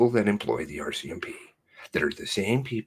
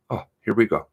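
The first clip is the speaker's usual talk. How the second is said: ordinary talk, nothing marked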